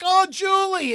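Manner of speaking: very high, loud sing-song hello; delighted call to an old friend; mom greeting a friend. Retold or imitated. Mom hailing a woman from the car, high sing-song. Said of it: "And she's like".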